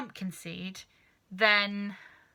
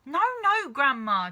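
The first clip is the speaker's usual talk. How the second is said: high and loud, pulling back; crying out; 6 semitones higher, 7 dB louder than usual. Acted. Boy crying out as grandma reaches for the cup, high and loud. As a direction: cry out no, no in alarm. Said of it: "George cried out".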